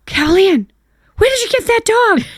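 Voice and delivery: high, loud shocked question; can't believe her eyes; shocked mom. Retold or imitated. Mother shocked to see daughter holding a dog, high loud disbelief.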